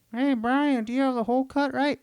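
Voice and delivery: high, chirpy put-on voice for the store guy; mock store clerk voice. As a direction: play the cheerful appliance clerk. Store staff voiced in phone call.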